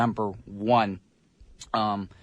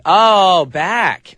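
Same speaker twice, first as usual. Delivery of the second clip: loud, higher oh of getting it; sudden realization; 5 semitones higher, 10 dB louder than usual. Real, not acted. Host realizing the misspelled song title, surprised burst. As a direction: suddenly get it and blurt it out. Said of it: "You wrote bake, so it threw me off".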